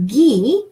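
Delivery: "Guy" said French-style as "ghee"; French "gui", rhymes with "key"; hard G. Shown as a demonstration. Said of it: "is pronounced".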